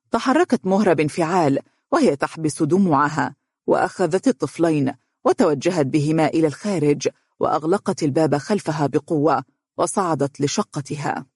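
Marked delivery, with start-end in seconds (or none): none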